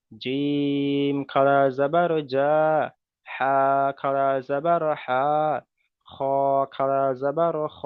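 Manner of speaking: slow, drawn-out letter-by-letter chant; slow recitation drill. Shown as a demonstration. Teacher modelling the letter line for students, slow chanted recitation. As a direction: recite each letter as a model, stretched slowly. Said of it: "খালা জাবার লাগিয়ে আমরা প্রথমে এক line উচ্চারণ করলাম".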